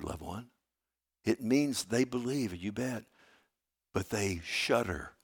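ordinary talk

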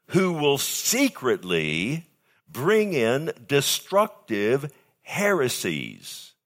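who will secretly bring in destructive heresies drawn out slowly, each word weighed; slow deliberate scripture reading; slow emphatic reading. Shown as a demonstration. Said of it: "listen carefully".

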